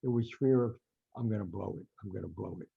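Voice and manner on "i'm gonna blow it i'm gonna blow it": low, hushed inner voice; private self-doubt. Retold or imitated. Storyteller voices the fighter's fear of losing, low and hushed. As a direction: murmur it as a worried thought, trailing softer.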